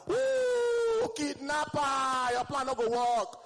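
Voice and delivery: loud high shriek, kidnapper drawn out slowly; loud shrieking yell. Retold or imitated. A woman in traffic screaming at the flirting man, loud shriek. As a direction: scream it at the stranger in the car.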